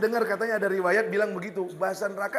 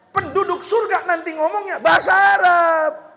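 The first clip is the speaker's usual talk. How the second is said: raised high and loud to drive the point home; preaching shout; 8 semitones higher, 9 dB louder than usual. Real, not acted. Preacher to congregation, shouting the point. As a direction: call it out to the whole hall.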